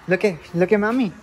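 fast, loud cheering; excited. Real, not acted. Excited mom cheering baby on.

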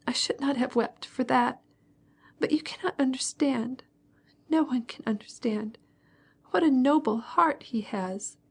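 fighting back tears in a hush; tearful girl. A narrator's reading. Sonya confiding to Natasha, tearful and hushed. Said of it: "once more her tears began to flow".

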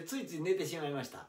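ordinary talk, nothing marked

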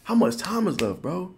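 high, loud retold protest; exasperated pleading. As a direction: act out a worn-out swimmer bargaining. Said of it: "I'm like".

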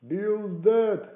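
high, very loud cry; shouting. A narrator's reading. Man in the house calling to the gang, loud shout. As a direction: shout the news to those outside. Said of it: "a voice shouting from the house".

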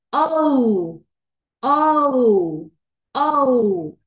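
long drawn-out O sound, said loudly. Shown as a demonstration. Teacher stretching the long O for students. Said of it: "Sonido largo".

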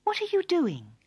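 put-on high, friendly young princess. A narrator's reading. Princess greets the old woman, friendly and high. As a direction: ask it lightly, pitched right up. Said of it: "said the friendly princess".

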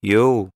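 "You" with a downward glide; falling intonation. Shown as a demonstration. Teacher showing learners where the tune falls, falling intonation on "You". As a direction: let the tune fall on "You". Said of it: "متى نزلت النغمة".

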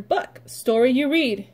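ordinary delivery

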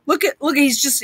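ordinary talk

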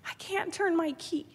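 normal talking voice

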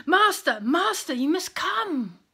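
urgent high, loud plea; frantic messenger calling for help; desperately upset. A narrator's reading. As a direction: cry out in desperate alarm, drawing out the last word. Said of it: "out of breath and desperately upset".

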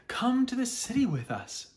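high, bright inviting voice; lightly voiced girl inviting the bear; girl and father voiced. A narrator's reading. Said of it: "they said".

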